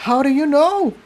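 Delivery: put-on high-pitched worried young pony; Tiptoe voice. A narrator's reading.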